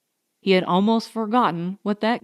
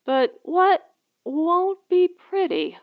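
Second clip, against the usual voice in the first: a higher, lightly voiced young woman, uneasy; a hint of a nervous young woman asking; lightly voiced Diane; 8 semitones higher than usual. A narrator's reading. Young wife asks her husband about danger, a little uneasy.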